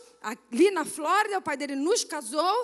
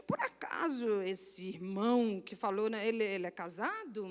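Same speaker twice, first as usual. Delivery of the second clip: sidle into it softly and hesitantly; coy hushed question; 7 semitones lower, 7 dB quieter than usual. Acted. Woman asking her pastor if he's married, coy hush.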